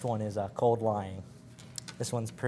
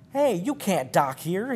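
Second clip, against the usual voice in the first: slow, higher bellow; 5 semitones higher, slower than usual. A narrator's reading. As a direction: yell it up at the bridge. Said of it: "he bellowed to the skipper".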